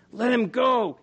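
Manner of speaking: put-on high, loud boy's shout. A narrator's reading. Barty shouts at the mob holding Arthur, shouting. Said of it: "he shouted".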